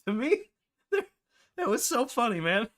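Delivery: high, laughing through the words. Real, not acted. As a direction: speak while cracking up. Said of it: "I'm in stitches".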